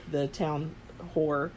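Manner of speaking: ordinary talk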